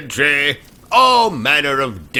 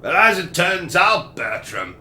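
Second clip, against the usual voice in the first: put-on British accent; 4 semitones higher than usual.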